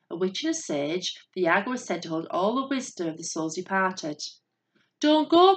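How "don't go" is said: high, loud warning cry; urgent bird's cry of warning. A narrator's reading. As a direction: call it out like alarmed birds. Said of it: "cried the birds".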